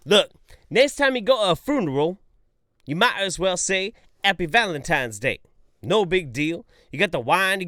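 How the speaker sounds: loud, high exasperated rant. Real, not acted. Ranting to stream chat, loud and high. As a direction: rail at the funeral speech.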